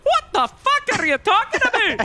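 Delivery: high, indignant put-on Scottish-Irish accent; hammed-up Scottish-Irish voice actress; mock Scottish-Irish outrage. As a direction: squawk it in a bad Celtic accent. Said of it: "she's like".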